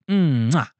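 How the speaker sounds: one loud smacking kiss; kiss sound. Pretend. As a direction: blow a single kiss. Blowing a kiss.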